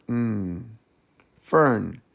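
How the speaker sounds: ordinary talk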